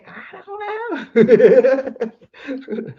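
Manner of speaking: high, drawn-out laugh through the words. Real, not acted. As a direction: laugh it off, very slowly. Laughs off his own theory.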